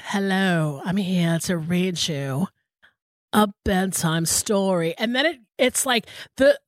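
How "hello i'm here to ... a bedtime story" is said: slow, lightly voiced seductive reader; a hint of a sultry celebrity reading; mock sexy narrator. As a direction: purr it like a bedtime story for adults. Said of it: "this thing where he's like".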